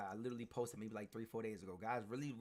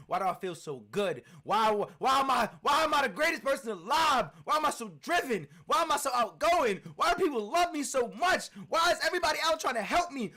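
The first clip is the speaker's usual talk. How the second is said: building to a full, much higher, louder shout; 13 semitones higher, 15 dB louder than usual. Retold or imitated. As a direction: roar back at your doubts, bigger with every why. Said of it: "You gotta tell that little voice in your head".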